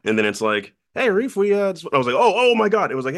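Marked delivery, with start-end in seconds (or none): 0.9-1.9 high, bright mock caller on voicemail; cheery voicemail voice. Voicemail from Rich and Alec. 2.2-2.9 higher and louder, stunned excitement; excited disbelief. Retold or imitated. Hearing the offer voicemail.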